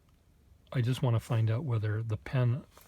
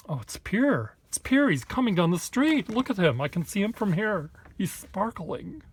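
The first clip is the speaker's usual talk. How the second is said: high, loud mock gush over a passer-by; gushing onlookers; 9 semitones higher, 5 dB louder than usual. Onlookers in Boston spotting Pierre with a sparkly sketchbook, high, loud mocking gush. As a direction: squeal it like starstruck fans spotting someone. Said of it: "they'll say".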